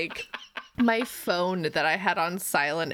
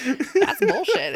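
laughing retort; 7 semitones higher, 5 dB louder, slower than usual. Retold or imitated. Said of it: "I'm just like".

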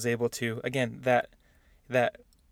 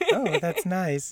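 higher, slow, savoring delivery; smitten approval; 5 semitones higher, much slower than usual. Acted. Guy reacting to a cute drawl, slow smitten approval. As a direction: draw it out, acting charmed. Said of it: "you're like".

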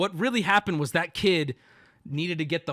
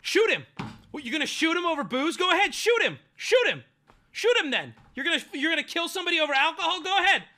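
daring yell; 11 semitones higher, faster than usual. Retold or imitated. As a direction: egg him on at full voice. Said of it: "he goes".

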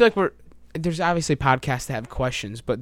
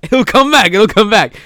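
high, loud mock-inventor yelling; insistent shout; 6 semitones higher, 10 dB louder than usual. Boomerang inventor to doubting onlookers, insistent shout.

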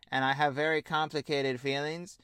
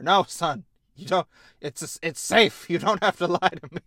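louder, faster reassurance; mock father insisting, raised voice; insistent dad; 3 semitones higher, 8 dB louder than usual. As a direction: steamroll him kindly but loud.

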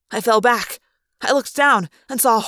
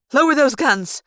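higher, sharply snapped command; snapped order; 4 semitones higher, 4 dB louder than usual. A narrator's reading. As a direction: snap it out at armed underlings. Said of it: "the woman snapped".

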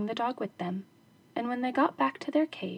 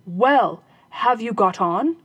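robber's voice; 8 dB louder, slower than usual. Acted. Robber voice in story.

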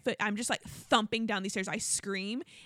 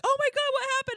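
much higher, alarmed call; alarmed husband; 6 dB louder, faster than usual. Retold or imitated. Husband calling down the stairs, higher and alarmed. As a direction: panic as if from upstairs. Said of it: "Justin's like".

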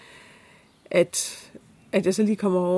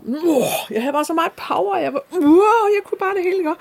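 high, rising, real elation; gushing about a glorious morning run; bursting with energy; 10 semitones higher, 5 dB louder than usual. Real, not acted. (begejstret, høj og opstemt)

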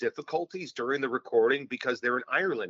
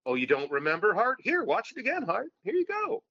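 higher, teasing mock taunt; taunting self; 9 semitones higher than usual. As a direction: tease yourself into watching again. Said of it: "mocking himself with the rerun".

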